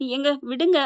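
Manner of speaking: plain reading voice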